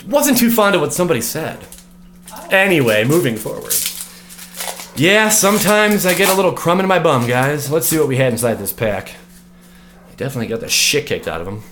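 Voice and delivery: ordinary delivery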